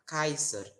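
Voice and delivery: Kaiser with a hard k and ai; restored Latin. Shown as a demonstration. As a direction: say Kaiser, kai-ser. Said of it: "ao invés de falar".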